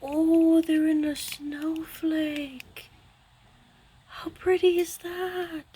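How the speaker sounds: high, slow, drawn-out wonder; enchanted coo. Real, not acted. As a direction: coo over it, stretching the words. Cooing over pretty watercolors.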